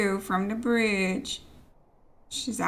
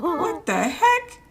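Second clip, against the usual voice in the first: high, slow drawn-out disbelief; startled; 8 semitones higher, 4 dB louder, slower than usual. Real, not acted. Watching a vampire fly past, higher and stunned. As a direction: hang on the heck, stunned.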